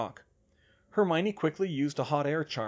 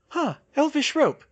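Hermione voice; 8 dB louder than usual. A narrator's reading. Hermione voiced, high pitch.

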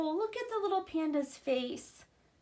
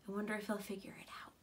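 whisper; 4 semitones lower, 9 dB quieter, much faster than usual. Real, not acted. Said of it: "aside whispered to listeners".

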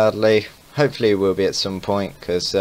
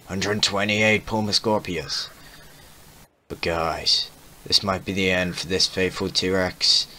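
ordinary talk

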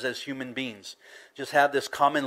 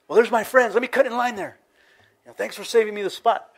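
high, louder, mock-breezy voice; line-cutter; 9 semitones higher, 6 dB louder, faster than usual. Person cutting in line to join friends, hammed-up breezy. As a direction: play someone brazenly barging in on friends.

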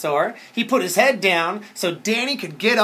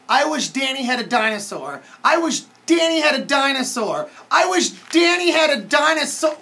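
repeated chant, rising higher each time; building wish; 7 semitones higher, 5 dB louder than usual. Pretend. Reader wishes along with the children, building excitement. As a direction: wish harder each round, climbing in pitch.

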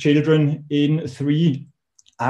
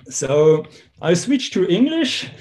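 ordinary delivery

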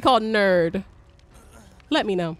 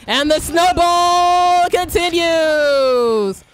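slow, drawn-out commentator line, louder; mock announcer; 4 semitones higher, 4 dB louder, much slower than usual. Streamer narrating teammates dying, slow mock announcer. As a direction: announce the disaster like a sportscaster.

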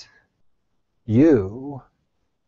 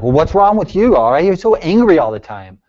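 loud, higher-pitched mock exasperation; husband's accusing complaint; 7 semitones higher, 8 dB louder than usual. Husband confronting his irritable wife, acted exasperated complaint. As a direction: imitate a fed-up husband demanding an explanation. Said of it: "and he's like".

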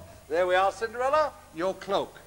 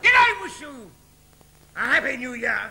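much higher, screeching panto dame voice; shrill panto ugly sister; 8 semitones higher, 3 dB louder than usual. Acted. Ugly sister orders Cinderella to tear the ticket, in a high screeching falsetto. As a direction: lord it over Cinderella in a piercing falsetto.

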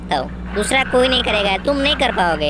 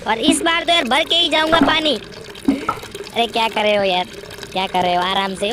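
ordinary delivery

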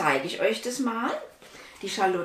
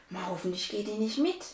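plain reading voice

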